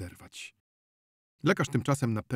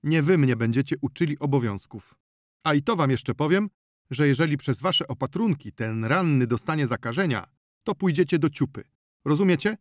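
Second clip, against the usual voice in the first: louder, higher, threatening tirade; indignant lecture in a raised voice; angry scolding; 5 semitones higher, 6 dB louder than usual. Acted. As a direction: berate an inferior sternly. Doctor angrily threatens the healer.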